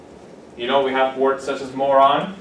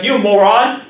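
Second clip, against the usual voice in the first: a louder, higher "You moron"; mock insult; 5 semitones higher, 6 dB louder, slower than usual. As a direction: snap it at someone as a joke. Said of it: "it's like".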